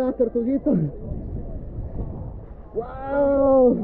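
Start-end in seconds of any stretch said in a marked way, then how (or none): none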